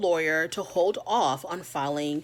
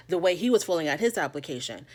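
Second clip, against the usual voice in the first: ordinary delivery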